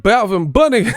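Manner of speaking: high, loud cartoonish blurt; hammed-up mock misnaming; mocking mispronunciation. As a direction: spit the name out like a goof. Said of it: "He said".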